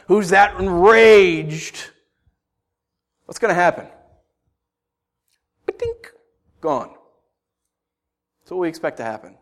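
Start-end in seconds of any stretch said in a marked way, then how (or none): 5.6-6.1 high, soft little impact sound; imitated hit noise. Preacher showing the enraged response, imitated soft hit noise. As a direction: make a tiny comic knock.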